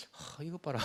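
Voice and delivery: half-laughing pretend grievance; mock hurt disbelief. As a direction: think aloud as if slightly let down. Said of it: "아, 좀 서운한 마음".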